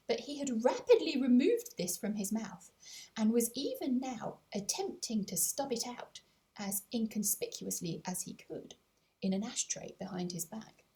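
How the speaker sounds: plain reading voice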